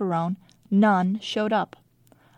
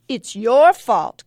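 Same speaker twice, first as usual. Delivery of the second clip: grinning; 3 semitones higher, 8 dB louder, slower than usual. A narrator's reading. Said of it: "Contessa grinned".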